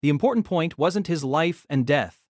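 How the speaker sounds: ordinary delivery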